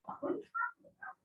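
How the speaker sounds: confused inner voice, high and soft; inner thought voice. Retold or imitated. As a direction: think it to yourself in quick disbelief. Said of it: "And I'm thinking".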